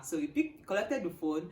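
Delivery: ordinary talk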